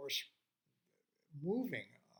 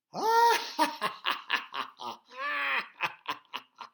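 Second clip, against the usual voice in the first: long, high, uproarious cackling laugh; hearty hammed-up belly laugh; loud acted laughing; 11 semitones higher, 14 dB louder than usual. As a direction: laugh out loud in big staccato bursts. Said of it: "she heard laughing".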